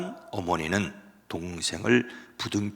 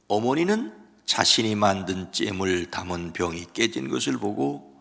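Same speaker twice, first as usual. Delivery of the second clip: ordinary delivery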